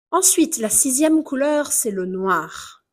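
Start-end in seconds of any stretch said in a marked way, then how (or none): none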